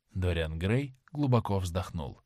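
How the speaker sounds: ordinary delivery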